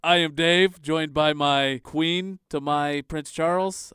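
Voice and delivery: loud host introduction, starting high and settling; podcast announcer intro. Acted. Host introducing himself and his co-host, loud announcer delivery. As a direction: announce the show and hosts with big projection.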